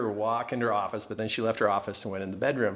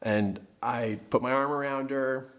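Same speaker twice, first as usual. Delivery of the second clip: ordinary delivery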